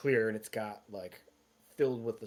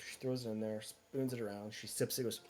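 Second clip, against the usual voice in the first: ordinary talk, nothing marked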